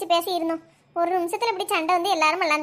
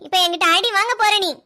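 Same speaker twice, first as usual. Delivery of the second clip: ordinary talk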